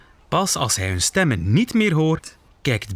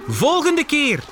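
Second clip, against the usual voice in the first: high, raised promise to a crowd; calling back; 13 semitones higher than usual. Acted. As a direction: call it out high over the clamour. Bear calls back a promise. (hoog terugroepend)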